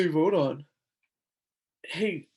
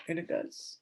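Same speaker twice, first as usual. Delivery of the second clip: breathy whisper, no voice; 7 dB quieter than usual. Real, not acted. Vlogger agreeing with herself in a whisper. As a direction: say it under your breath.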